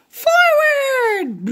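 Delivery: high little pig call; Piggy calling the move to Gerald, high voice. Acted.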